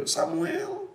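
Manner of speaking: high, soft call from a distance; a mother calling from far away. Retold or imitated. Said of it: "sayup-sayup ada suara nama saya dipanggil". (panggilan samar dari kejauhan)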